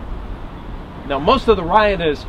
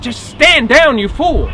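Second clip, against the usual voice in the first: high, raised-voice plea; urgent shout; 8 semitones higher, 5 dB louder, slower than usual. Acted. Marshal watches bayonets close on Bell, shouting urgently. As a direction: shout to stop a stubborn man. Urging man about to be skewered.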